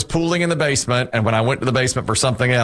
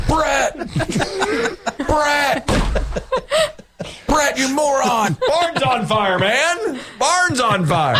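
hammed-up goose bellowing the alarm; goose honk as a shouting man; 14 semitones higher, slower than usual. Goose yelling at its owner to wake him: frantic shout. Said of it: "Here's a recreation of the honk".

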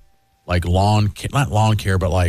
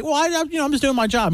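high, sheepish mock protest; defensive salesman. Salesman answering the brother's complaint, high and defensive. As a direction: imitate a salesman excusing himself, pitched up.

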